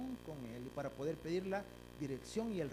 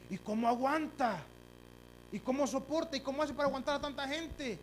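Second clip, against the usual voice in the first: louder and higher, preaching hard; fiery preaching; 8 semitones higher, 5 dB louder than usual. Acted. Preacher to the congregation about difficult people, fiery. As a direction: build the sermon to a shout.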